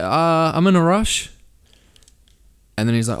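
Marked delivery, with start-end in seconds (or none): 0.0-1.3 higher, hesitant excuse; awkward brush-off. Retold or imitated. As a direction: beg off nervously. Brushing off the stranger.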